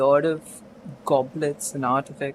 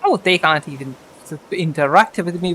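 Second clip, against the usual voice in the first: ordinary talk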